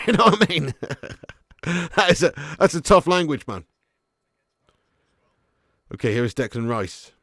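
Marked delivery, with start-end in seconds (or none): none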